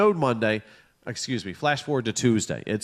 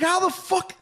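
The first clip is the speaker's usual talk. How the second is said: incredulous. Retold or imitated. Incredulous at wife.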